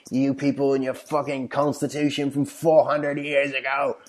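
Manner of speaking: a hint of a Scottish rant; Scottish yelling. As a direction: imitate the Scot yelling about guns. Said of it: "starts just yelling at me".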